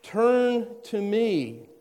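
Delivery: high, drawn-out pleading call; God pleading with his people to turn back; urgent plea. Acted. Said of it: "there's an urgency here".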